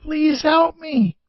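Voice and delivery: slow, high pained plea; a wounded man begging, drawn out; moaning. Acted. Wounded officer on the ground begs for help, moaning. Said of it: "the officer moaned".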